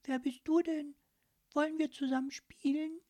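put-on high, eager child voice; curious little girl. Girl asking the mussel to play, high and eager. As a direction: sound like a small friendly girl. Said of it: "sagte Anne erstaunt".

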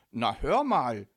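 loud, affronted call back; loud retort. A narrator's reading. Micky answering the ghost who yelled, loud retort. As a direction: shout back in protest. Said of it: "rief er laut zurück".

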